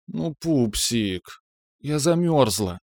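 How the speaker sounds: slow, whiny, pouting plea; whiny spoiled pouty drawl. Acted. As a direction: whine it like a spoiled girl. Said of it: "капризным голосом проныла".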